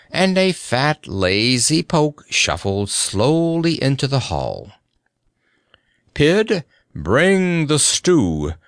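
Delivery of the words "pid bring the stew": high, drawn-out knightly bellow; roared command. A narrator's reading. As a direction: call out the order, stretching it. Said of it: "roared Sir Hokus".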